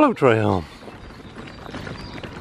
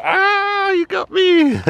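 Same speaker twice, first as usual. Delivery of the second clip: high, hammed-up "ah, you got me"; mock surprise; much slower than usual. Dad pretending his son caught him, high squeal. Play-fear after the growl game.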